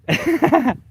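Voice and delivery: high, loud burst of laughter; a sudden delighted laugh. Real, not acted. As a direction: cracks up at the comment. Laughs at the Australian's remark.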